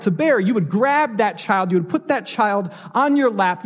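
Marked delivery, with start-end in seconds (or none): none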